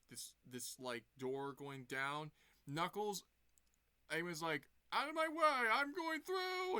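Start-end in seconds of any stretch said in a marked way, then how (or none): 4.9-6.8 much higher, loud, stretched-out yell; bossy shout. Retold or imitated. Eggman shoving past Knuckles under the door, shouting. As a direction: bulldoze through and bark the order.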